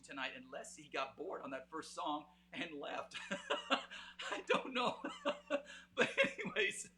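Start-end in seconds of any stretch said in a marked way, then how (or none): 3.3-5.0 words spilling out through a big laugh; giggling at his own joke about dad leaving. Real, not acted. 5.0-5.9 high burst of real laughter; high, loud laughing burst. Real, not acted. Laughs at his own joke. 6.0-7.0 laughing while getting back on track. Real, not acted. Still laughing, moving on.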